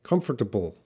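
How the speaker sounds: British Comfortable with a crisp t in the middle, KUM-fuh-tuh-bul; British pronunciation. Shown as a demonstration. Teacher shows students the British way to say Comfortable. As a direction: sound out Comfortable the British way, t clearly hit. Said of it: "has a stronger T sound in the middle".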